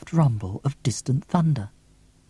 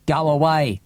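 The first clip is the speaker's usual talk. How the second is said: mild yell; 3 semitones higher than usual. A narrator's reading. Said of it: "yells Griffin".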